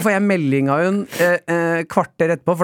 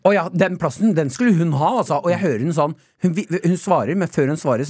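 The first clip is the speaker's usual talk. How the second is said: ordinary talk, nothing marked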